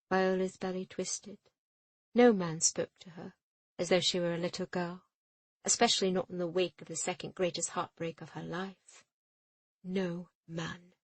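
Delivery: ordinary delivery, nothing marked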